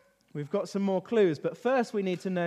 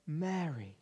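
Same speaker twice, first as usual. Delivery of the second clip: hushed, low, tender naming; quietly calling someone grieving by name; 5 semitones lower, 10 dB quieter than usual. Acted. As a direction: drop to a soft, gentle call. Jesus calls the weeping Mary.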